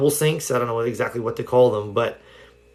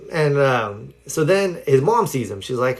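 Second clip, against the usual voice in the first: ordinary delivery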